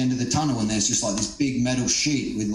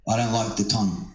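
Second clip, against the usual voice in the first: ordinary talk, nothing marked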